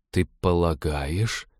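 slow, drawn-out cautious question; tentative and unhurried. A narrator's reading. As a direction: feel out the idea carefully, slowly. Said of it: "осторожно спросил он".

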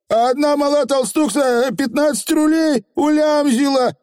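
высокий восторженный голос фигля; расскажи с восторгом, повыше; с восхищением. A narrator's reading. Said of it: "добавил он с восхищением". (восхищённо)

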